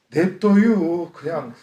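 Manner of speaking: slow, drawn-out Chungcheong market woman; Chungcheong vendor drawl. Retold or imitated. Market woman refusing the customer, slow Chungcheong drawl. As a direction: stretch it out lazily like a Chungcheong vendor. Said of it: "그 시장에서도 그런다며요".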